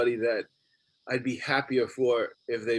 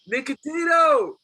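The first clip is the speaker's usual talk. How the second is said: long, high calling yell, much higher and louder; yelled name; 12 semitones higher, 5 dB louder than usual. Retold or imitated. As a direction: shout his name across a busy club, slowly. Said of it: "one of the waitresses yells out".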